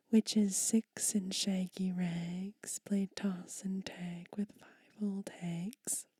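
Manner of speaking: rhythmic whispered poem, slow and emphatic; poem reading. Acted. Whispered children's poem read aloud to ASMR viewers. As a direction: read the rhyme with a bouncing beat.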